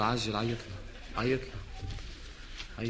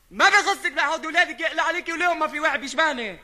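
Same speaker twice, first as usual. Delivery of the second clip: higher, louder snapping back; angry retort; 6 semitones higher, 4 dB louder than usual. Acted. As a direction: snap back at her, offended. Wife quarrel on phone.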